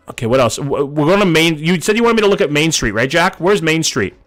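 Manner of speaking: ordinary talk, nothing marked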